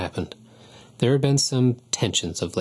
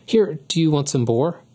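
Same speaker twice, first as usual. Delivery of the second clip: calling after her; 6 semitones higher, 4 dB louder than usual. A narrator's reading. Boy calling after angry Nancy.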